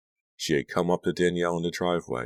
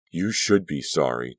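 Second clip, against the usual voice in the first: usual speaking voice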